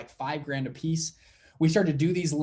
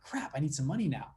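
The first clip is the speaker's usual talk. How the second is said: softer, whispered inner panic; panicked whisper; 6 dB quieter than usual. Retold or imitated. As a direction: whisper it like a sudden private panic. Panicked thought about needing money.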